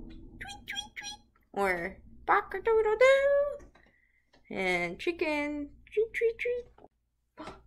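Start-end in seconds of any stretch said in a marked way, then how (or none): 0.1-1.2 tiny, very high, soft cheeps; chick tweet. Shown as a demonstration. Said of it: "What did chicken say". 2.3-3.7 high-pitched cock-a-doodle-doo; rooster crow. Shown as a demonstration. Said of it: "What did chicken say". 5.9-7.5 very high, drawn-out cheeps; slow squeaky chick peeps; chick tweet. Shown as a demonstration. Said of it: "What did chicken say".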